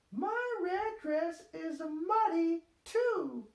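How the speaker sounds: put-on high, slow little-girl pig voice; Peppa voice. A narrator's reading. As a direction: speak slowly as small Peppa. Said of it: "thinks Peppa".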